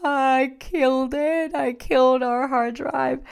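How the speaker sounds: high, slow, despairing; high, drawn-out despair; despairing confession. Retold or imitated. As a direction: wail it out in despair. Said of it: "I'm like".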